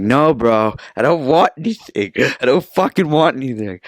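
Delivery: mock outburst, pitch climbing; exasperated refusal. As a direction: act out fed-up pleading with a vendor. Imagined outburst at persistent vendors.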